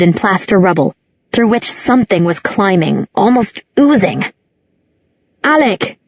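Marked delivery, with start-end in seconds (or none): 5.4-6.0 sharp high shout of a name. A narrator's reading. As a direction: call out in alarm. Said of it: "It was Jace shouting".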